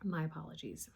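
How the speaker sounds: just swallowed a sip; speaking after a gulp of drink. Real, not acted. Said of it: "you're gonna see me drink".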